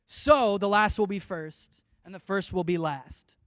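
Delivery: measured, public Bible reading; steady preacher's reading voice; scripture reading. Acted. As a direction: read the passage to the congregation. Reads the parable aloud.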